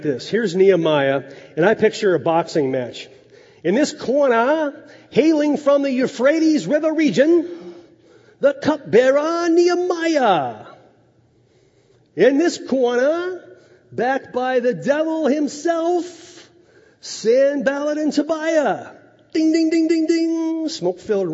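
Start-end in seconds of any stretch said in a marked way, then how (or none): 3.6-18.9 high, slow boxing announcer call; ring announcer. Acted. 19.3-20.7 high sung ding of a ring bell; mock boxing bell.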